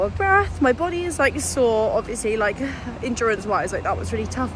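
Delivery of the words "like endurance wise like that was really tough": ordinary talk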